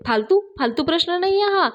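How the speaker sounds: high-pitched, hurried objection; flustered defence. Acted. Loan officer flustered by mechanic's outburst, high and defensive. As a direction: snap back, rattled.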